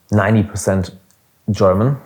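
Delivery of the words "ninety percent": ordinary talk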